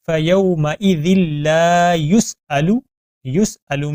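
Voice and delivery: slow melodic tajweed chant; Quran recitation. Shown as a demonstration. Recitation demo.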